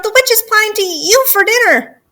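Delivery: high-pitched alarmed shout; frantic warning cry; yelled warning. Acted. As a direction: blurt out the danger at high pitch. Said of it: "she yelled".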